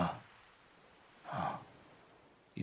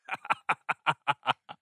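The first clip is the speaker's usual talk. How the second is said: unhinged laugh; 4 semitones higher than usual. Acted. Salt laughing madly.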